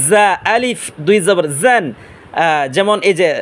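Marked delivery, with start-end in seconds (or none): 0.1-1.8 জান with a buzzing z like a bee; slow recitation, humming z in জা and জান. Shown as a demonstration. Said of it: "মৌমাছির আওয়াজে".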